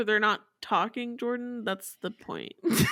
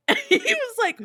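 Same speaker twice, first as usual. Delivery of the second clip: usual speaking voice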